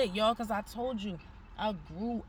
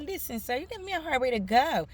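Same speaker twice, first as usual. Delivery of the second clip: fed up; 6 semitones higher, 4 dB louder than usual. Retold or imitated. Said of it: "I told him, I said".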